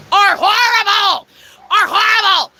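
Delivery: slow, high, emphatic yell; exasperated rant at full voice; outraged shout. Real, not acted. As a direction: shout it out in indignation, stretching each word. Outraged rant about human beings.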